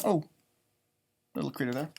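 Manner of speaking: plain reading voice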